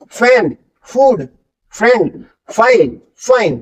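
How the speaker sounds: "फैन, फूड, फ्रेंड, फाइल, फाइन" slowly, each F hissed through teeth on lip; teacher stretching every F in the words. Shown as a demonstration. Teacher reading F words slowly for students to copy. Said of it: "जाइए बोल के देखिए".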